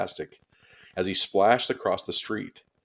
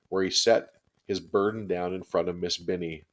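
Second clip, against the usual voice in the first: usual speaking voice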